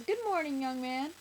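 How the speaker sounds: high, loud, cheerful greeting; cheery doorman. A narrator's reading. Doorman greeting the little boy, cheery. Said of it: "their doorman says".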